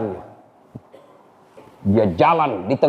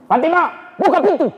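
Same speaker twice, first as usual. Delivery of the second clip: demanding call; 12 semitones higher, faster than usual. Acted. Umar at sister's door.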